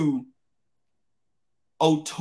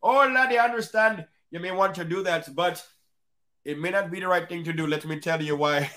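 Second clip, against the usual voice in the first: put-on Irish accent; faster than usual. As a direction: advise like an old Irish pub regular. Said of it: "Don't y'all laugh at my Irish accent".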